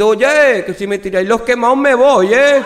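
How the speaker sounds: loud, high, indignant shout. Acted. Basque man yells up at the people throwing down the burnt, loud and high. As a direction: yell it up at them, fed up.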